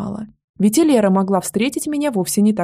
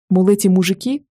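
usual speaking voice

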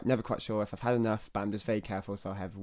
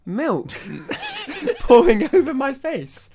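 high, loud, laughing through it; laughing mock panic; 8 semitones higher, 10 dB louder than usual. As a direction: giggle through a fake crash. Acting crash with milk.

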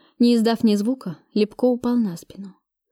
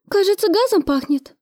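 high-pitched anxious forewoman; worried; 10 semitones higher than usual. A narrator's reading. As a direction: voice a worried shift supervisor, pitched up. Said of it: "сказала обеспокоенная сменная мастер". (обеспокоенно, высоким голосом)

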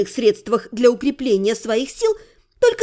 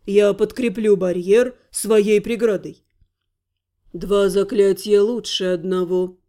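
deep, slow deliberate voice; measured low wizard voice; low character voice; 6 semitones lower than usual. Acted. Voices Lukas then mage.

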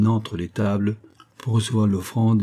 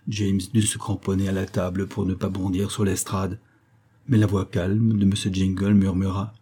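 ordinary delivery

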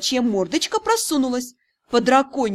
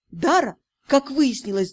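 ordinary delivery, nothing marked

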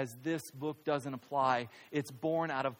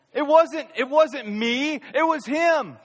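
high and loud, stress on wasnt and him; loud excuse; 11 semitones higher, 8 dB louder than usual. Retold or imitated. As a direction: push the blame away loudly: "It wasn't me". Mock excuse, then quiet repeat.

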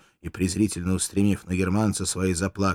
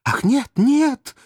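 feigned terror; 6 dB louder than usual. Acted. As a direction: cry out in faked horror. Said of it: "закричала куртизанка, притворяясь поражённой ужасом".

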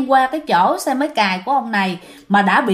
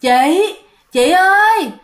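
ghost calling; 5 semitones higher than usual. Acted. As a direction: tease the passing women like a lurking ghost. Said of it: "nghe tiếng kêu".

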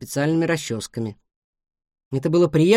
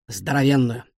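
ordinary delivery

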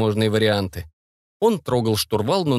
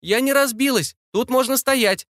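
high, amazed call; high-pitched astonished shout; excited calling out; 12 semitones higher than usual. A narrator's reading. Calling up from outside plane.

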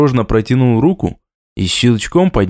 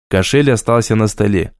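plain reading voice

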